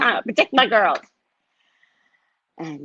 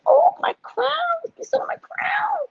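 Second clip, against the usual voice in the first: very high, drawn-out squeal; mock squeal; slower than usual. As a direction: squeal it slowly at the top of your range. Mock panic, retelling her outburst.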